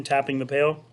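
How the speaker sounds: ordinary delivery, nothing marked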